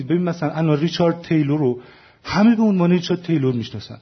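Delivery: ordinary talk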